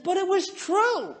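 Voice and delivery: high, drawn-out protest; indignant protest. Retold or imitated. Husband defending himself to hurt wife, high indignant protest.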